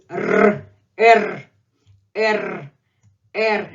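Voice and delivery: "R" with a trilled tongue tip; rolled Russian R. Shown as a demonstration. Teacher models the tricky letter for English speakers, trilled "R". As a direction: roll each "R" on the tongue tip. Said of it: "the most difficult sound to pronounce".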